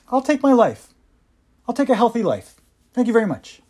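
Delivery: lightly voiced athlete choosing health. Quoting Ricky's decision.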